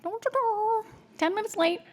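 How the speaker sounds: high, put-on scolding voice, hammed up; a playful impression of herself nagging her husband about being late; mock nagging. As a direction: squeak it out like a mock nag. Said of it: "And I'm like".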